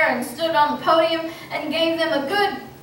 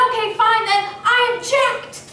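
mock courtroom objection; sassy objection; 8 semitones higher, 4 dB louder than usual. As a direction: throw the objection back cheekily. Defiant retort to chairman.

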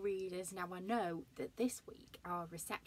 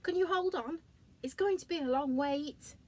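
giraffe voice; 8 semitones higher, 7 dB louder than usual. Acted. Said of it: "said Giraffe".

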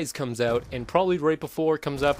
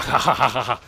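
hammed-up villain cackle, louder; mock evil laugh; 3 semitones lower, 6 dB louder than usual. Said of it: "and it's like".

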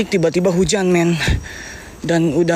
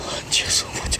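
frightened whisper; scared whisper; 8 dB quieter, slower than usual. Real, not acted. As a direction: breathe it out in fear. Scared after hearing a noise.

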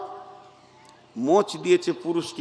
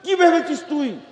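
mock angry imam, pitched higher; imam's angry rebuke; 8 semitones higher than usual. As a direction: snap at someone as an angry imam. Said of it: "ইমাম সাহেব রেগে গেছে". (রেগে যাওয়া ইমামের ধমক, উঁচু গলায়)

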